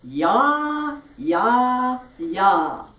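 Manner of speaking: each "yah" held long with a wide-open jaw; jaw-opening drill. Shown as a demonstration. Teacher demonstrating a jaw exercise, "yah" wide and sustained. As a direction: step "yah" down from high, loud and open. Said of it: "for the jaw opening".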